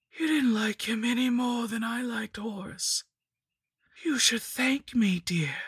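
high mocking crone voice; a gleeful, taunting old hag; witch voice. The hag mocks Marion's husband.

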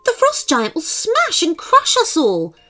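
panicky. Acted. Firefox panics.

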